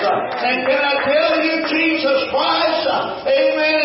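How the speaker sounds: preaching at full force. Acted. Preacher rousing his congregation, chanting and fervent. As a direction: lift the church with a chanting preacher's cry.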